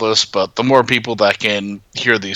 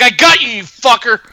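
triumphant shout; 12 semitones higher, 5 dB louder than usual. Retold or imitated. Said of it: "be like".

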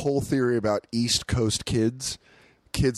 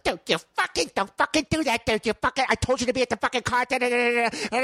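high rapid mock tirade; hammed-up raging friend; imitated screaming; 10 semitones higher, faster than usual. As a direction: rant in a raised voice until words blur. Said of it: "Bobby scream at you".

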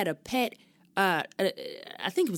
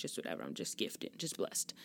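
tossed-off breathy whisper, much softer; whispered mock brag; 10 semitones lower, 11 dB quieter than usual. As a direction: brag it under your breath. Joking brag after DJ bit.

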